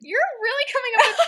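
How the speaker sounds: high laughing squeal. Real, not acted. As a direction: laugh through the words. Laughing at friend's theories.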